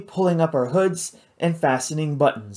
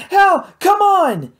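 loud, high, slow drawn-out call; urgent shout; 6 dB louder, slower than usual. A narrator's reading. Matt calls Hal to flee the creature, loud slow shout. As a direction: haul him out with your voice.

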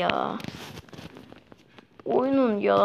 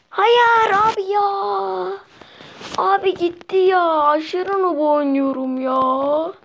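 real frustration; 9 semitones higher, slower than usual. Real, not acted. Gamer to viewers after losing the zombie, frustrated high wail. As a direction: moan that the zombie got away. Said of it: "çok sinir oldum".

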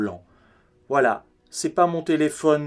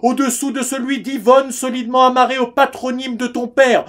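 higher-pitched, indignant mother on the phone; outraged mother; 9 semitones higher, 5 dB louder than usual. Acted. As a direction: reproach him in one sustained, offended sweep. [name] acts out his outraged mother. (mère outrée, ton de reproche aigu)

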